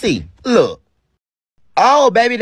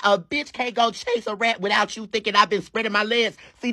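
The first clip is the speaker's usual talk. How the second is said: ordinary talk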